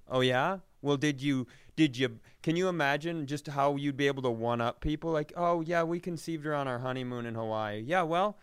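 usual speaking voice

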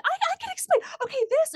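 very high, loud stammer; hammed-up squealed panic; mock desperate grasping. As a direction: imitate her frantically scrambling for an excuse.

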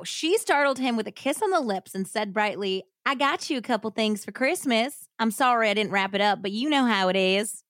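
Southern hillbilly accent, played for laughs. Shown as a demonstration. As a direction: read it in a put-on hillbilly twang that drifts toward Texas. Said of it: "I'm going to attempt a hillbilly accent".

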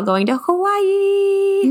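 high, long squeal on the last word; real excitement, drawn out; excited announcement. Real, not acted. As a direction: sing out the big news, stretched and high.

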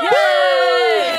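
high, loud happy yay; excited cheer. Real, not acted. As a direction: cheer them in with a squeal. Cheering the guests' introduction.

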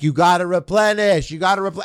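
high, insistent mock yell. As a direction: yell it like a scolding parent. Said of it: "they're, like, yelling at him".

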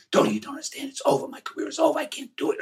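airless whispered rasp of a lost voice; hoarse whisper. Retold or imitated. Son imitating his voiceless father's whisper. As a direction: imitate a man with no voice, all breath. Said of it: "it sounded like this".

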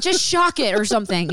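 high, frantic mock plea; frantic demand. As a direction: beg like a hysterical owner.

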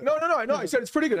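defensive protest. Retold or imitated. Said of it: "they'd be like".

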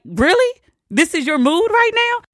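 retold disbelief, very high questioning pitch; incredulous challenge. Woman challenging cousin's mood after the rescue, incredulous. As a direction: question him as if you can't believe it.

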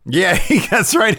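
high, laughing agreement; laughing while talking. Real, not acted. Laughing with co-host about the wrong name, cracking up. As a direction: agree through a burst of laughter.

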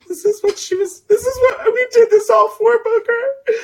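high, crying squeal; tearful. Retold or imitated. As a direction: wail it through happy tears. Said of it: "almost brought tears to my fucking eyes".

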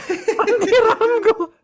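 slow, high, laughed-out repeat; laughing. Real, not acted. Co-host repeating the contestant's guess, laughing hard. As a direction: squeeze the name out through laughter.

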